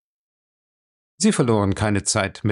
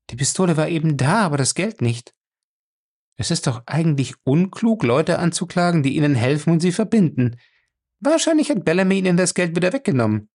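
higher woman's voice, faster, lightly reproachful; Fay's voice; 6 semitones higher than usual. A narrator's reading. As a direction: scold him gently, as Fay. Narrator voices Fay.

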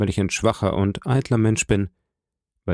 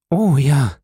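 höher und lauter, fallend; überzeugt bejahen; freudige Zustimmung; 5 semitones higher, 5 dB louder than usual. A narrator's reading. Julie bejaht begeistert. (aus vollem Herzen zustimmen)

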